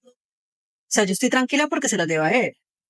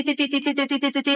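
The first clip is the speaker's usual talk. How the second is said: higher, rhythmic ti ti beeps; imitated monitor beeps; 5 semitones higher than usual. Telling the host how the monitor started beeping, imitated beeps. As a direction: mimic the heart monitor beeping steadily.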